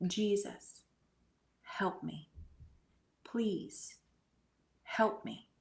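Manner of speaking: retold whispered prayer for help; a hushed, pleading whisper to God; whispered plea. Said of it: "And I say".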